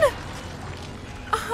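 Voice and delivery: breathless panicky laugh; nervous laugh. Real, not acted. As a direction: laugh from nerves.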